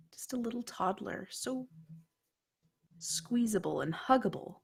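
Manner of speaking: dark dream narration; hushed horror narration. A narrator's reading. GM narrating a creepy dream scene.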